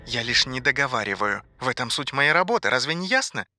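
soft-level voice from a television; dismissive TV announcer. Acted. TV announcer explains his job to Ivan, dismissive. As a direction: explain it away as if obvious.

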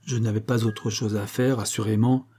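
usual speaking voice

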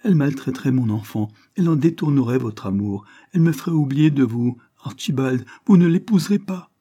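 feverish, insistent appeal to a husband; desperate, climbing outburst; distraught pleading. A narrator's reading. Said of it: "Elle versa un torrent de larmes".

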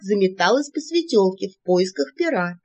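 ordinary delivery

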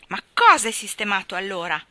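loud angry demand; father angrily demanding answers; with anger. A narrator's reading. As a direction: throw the question at them in anger. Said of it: "disse il padre con ira".

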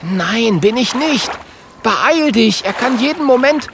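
high, exasperated hurry-up; alarmed, high-pitched insistence; urgent warning. A narrator's reading. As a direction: urge them to flee in a high voice. Dragon urgently warns seal.

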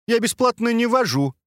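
high playful voice of a lift; elevator voice. Acted. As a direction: answer for the lift in a squeaky tease. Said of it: "ответил за лифт скат".

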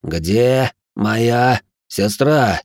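very slow, word by word; separating each word. Acted. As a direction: space out every word deliberately. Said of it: "разделяя слова".

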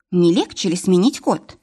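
ordinary delivery

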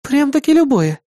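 "любое" high, as a sly question; sly smile. A narrator's reading. Partner probing the wager's prize, "любое" slyly questioned in high voice. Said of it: "хитро улыбнувшись, спросила Кри".

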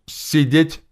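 loud, high, sudden bark; sharp shouted command; barked order. A narrator's reading. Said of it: "Я тут же рявкнул".

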